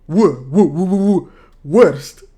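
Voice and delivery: repeated punched "w" sounds leading into "worst"; stressed English W. Shown as a demonstration. Streamer drilling the W for viewers, loud rounded "w" into "worst". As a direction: hammer the "w" in short bursts, then "worst". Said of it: "I say the W".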